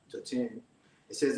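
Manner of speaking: ordinary talk, nothing marked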